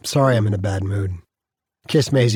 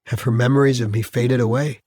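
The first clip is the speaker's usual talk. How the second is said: ordinary delivery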